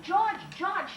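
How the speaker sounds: high calling out of a name. A narrator's reading. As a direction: call after him down the hall. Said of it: "she said".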